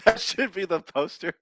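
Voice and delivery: laughing. Real, not acted. Laughing at own joke.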